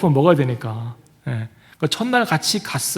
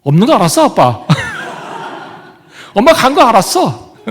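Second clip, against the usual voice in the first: put-on higher and louder, laughing through a child's answer; son's laughing reply; 6 semitones higher, 7 dB louder than usual. Pastor imitating his son's answer to the congregation, laughing and loud. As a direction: play the little boy blurting it out with a laugh. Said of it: "했더니".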